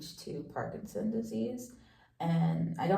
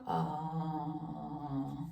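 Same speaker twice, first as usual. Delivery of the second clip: saying ahh; 4 dB quieter than usual. Real, not acted. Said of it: "Now can you say".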